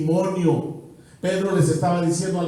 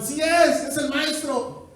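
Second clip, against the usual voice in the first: higher, thrilled cry of recognition, slowing at the end; excited recognition; 11 semitones higher, slower than usual. Acted. John recognizing the master on the beach, thrilled. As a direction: gasp it out as you spot him.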